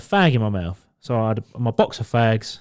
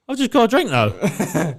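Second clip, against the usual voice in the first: retold protest; 6 semitones higher, slower than usual. Acts own protest to doorman.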